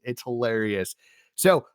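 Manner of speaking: ordinary talk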